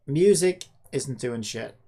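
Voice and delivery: ordinary talk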